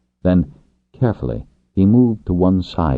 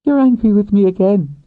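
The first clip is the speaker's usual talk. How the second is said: louder, much higher woman's voice; accusing woman; 5 dB louder than usual. Acted. Woman rider catching her lover's anger, accusing. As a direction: exclaim it as an accusation.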